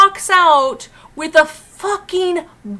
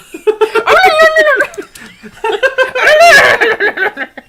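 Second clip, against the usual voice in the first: loud, high gobble breaking into laughter; botched turkey gobble; 11 semitones higher, 10 dB louder than usual. Pretend. Joking that angry Stephanie is a turkey, botched gobbling. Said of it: "Can't even do a good turkey sound".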